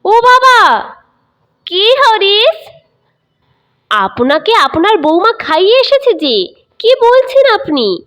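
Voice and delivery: put-on high, loud call to the old father. Son calling out loudly to his old father. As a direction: raise the voice to reach him. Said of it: "বাবা চেঁচিয়ে ডেকে বলেন".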